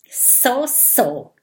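slow threatening snake hiss; a lightly voiced menacing snake. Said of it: "zischelt die Schlange bedrohlich".

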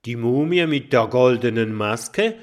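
loud disbelieving question; can't believe his ears; astonished. A narrator's reading. Kokosnuss hears of the golden mask, astonished. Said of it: "traut seinen Ohren nicht".

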